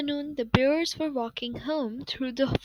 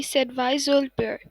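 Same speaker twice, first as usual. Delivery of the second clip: plain reading voice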